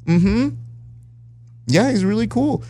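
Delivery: high, indulgent agreement; polite humoring. Retold or imitated. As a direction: nod along patiently. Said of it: "And you go".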